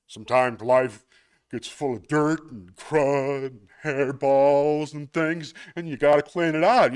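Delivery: loud goofy clueless guy; dumb guy voice. Acted. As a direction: play it dim and earnest. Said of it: "this is gonna be my dumb guy voice".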